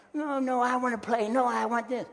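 high, loud bratty child imitation; whining defiant child. Four or five year old refusing to put it down, in mock whine. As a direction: mimic a little one refusing to obey.

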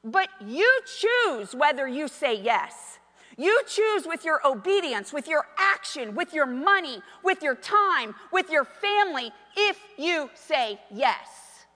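loud, high, slow preacher's proclamation; full-force sermon delivery; preaching. Acted. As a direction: drive each phrase home loudly, stretching the key words.

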